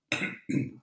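quick rough throat clear. Real, not acted. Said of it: "jetzt geht's aber".

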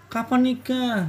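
mocking. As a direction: imitate a needling relative asking. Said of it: "tapi nadanya mengolok".

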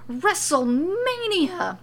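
high, drawn-out proclamation; huge, stretched-out shout; loud announcing. A narrator's reading. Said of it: "he announces as loud as he can".

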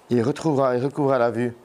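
usual speaking voice

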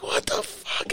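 soft unvoiced whisper; muttered to friends about Pills, whispered; whispered aside. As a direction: breathe it under your breath.

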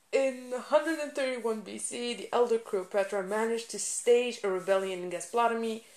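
ordinary delivery

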